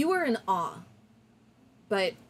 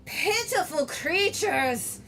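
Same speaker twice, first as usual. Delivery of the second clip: slow, huge, booming threat; booming dragon voice; 5 semitones higher, 4 dB louder than usual. Acted. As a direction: roar it down like a dragon. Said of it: "in a terrible booming voice".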